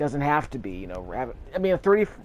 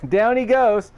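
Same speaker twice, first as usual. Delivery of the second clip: loud, high, drawn-out crow of triumph; gleeful cheer; 11 semitones higher, 9 dB louder, slower than usual. Real, not acted. Player gloating over a felled enemy, gleeful cheer. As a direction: stretch it out high and loud, with a laugh around it.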